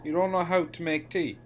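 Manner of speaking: put-on exasperated objection; mock indignant telling-off. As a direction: cut in, loudly playing the affronted tea expert. Player scolds the instructing robot.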